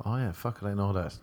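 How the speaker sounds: ordinary delivery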